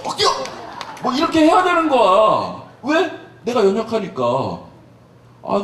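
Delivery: normal talking voice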